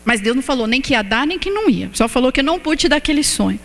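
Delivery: normal talking voice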